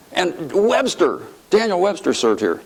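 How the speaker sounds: Sonny in awe. Retold or imitated. Congressman naming great predecessors to his friend, awed. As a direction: imitate a marvelling colleague. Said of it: "he was just in awe of the institution".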